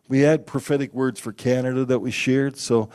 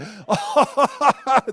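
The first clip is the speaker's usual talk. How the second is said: harder laughter; 11 semitones higher than usual. Retold or imitated. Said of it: "they laughed harder".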